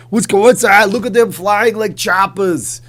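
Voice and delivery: loud, high Austrian excitement; booming Austrian accent pitched high; Arnold Schwarzenegger. Acted. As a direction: Austrian action star, hammed up. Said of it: "Arnold Schwarzenegger impression".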